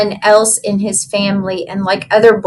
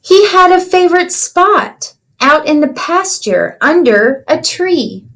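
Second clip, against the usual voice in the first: storybook reading. Acted. Teacher reads picture book aloud.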